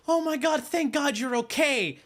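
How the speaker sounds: high, relieved gushing. Retold or imitated. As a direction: play her relief, up high. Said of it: "was like".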